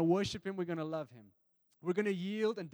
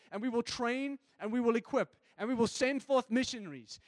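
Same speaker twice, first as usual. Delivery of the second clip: loud, high, building fervent preaching; preaching at full force; 3 semitones higher, 4 dB louder than usual. Acted. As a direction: rise into a passionate shouted sermon.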